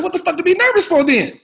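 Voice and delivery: loud, high incredulous demand; Sam Jackson. Retold or imitated. Star questioning a rookie who admits nerves, exasperated. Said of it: "He said".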